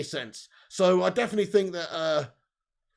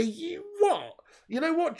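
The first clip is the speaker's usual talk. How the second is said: surprised; 10 semitones higher, slower than usual. Real, not acted. Surprised at chat comment.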